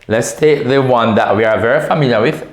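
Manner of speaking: ordinary talk, nothing marked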